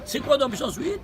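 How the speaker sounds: ordinary talk, nothing marked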